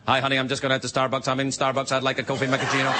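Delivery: fast monotone auctioneer chant at low pitch; auctioneer patter. Acted. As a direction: rattle off everyday talk like an auctioneer. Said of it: "guys that talk like that".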